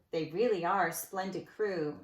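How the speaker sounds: ordinary delivery, nothing marked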